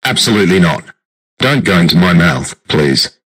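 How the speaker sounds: loud, emphatic refusal; adamant refusal. Acted. Biden forbids her to go into his mouth, adamant. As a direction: forbid it at full volume.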